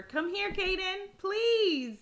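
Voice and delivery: high, drawn-out coaxing plea; pleading. Real, not acted. Mom to shy Kaden, pleading. Parent begging shy child to come.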